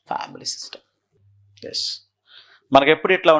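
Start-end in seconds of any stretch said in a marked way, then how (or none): 0.1-1.9 whisper. Real, not acted. Teacher repeating term to herself.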